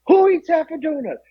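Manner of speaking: high-pitched mock disbelief; incredulous coworkers. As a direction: act baffled that anyone would do it. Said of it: "They're like".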